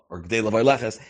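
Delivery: usual speaking voice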